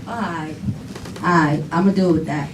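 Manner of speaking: low, soft giving-in; subdued, reluctant acceptance; resigned retold reply. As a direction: act out a child backing down quietly. Child gives in to father.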